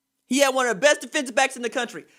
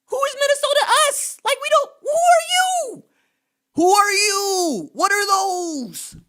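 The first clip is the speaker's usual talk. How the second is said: much higher, louder, squeaky disbelief; incredulous mockery; 10 semitones higher, 4 dB louder than usual. Real, not acted. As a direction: scoff like they're nobody. Host mocks Minnesota's arrogance.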